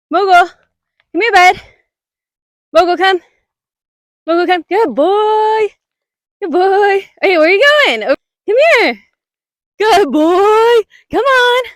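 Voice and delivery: high, bright dog-calling voice; sing-song call to a puppy. Real, not acted. Calling her dog on the trail.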